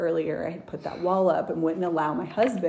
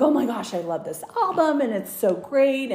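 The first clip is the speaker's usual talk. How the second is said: higher, gushing oh my gosh; mock the bride's delight, pitched up; imitating excited bride; 7 semitones higher than usual. Bride gushing to the hairdresser about the album, higher. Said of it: "And she's like".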